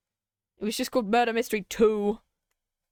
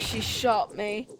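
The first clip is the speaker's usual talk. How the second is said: ordinary talk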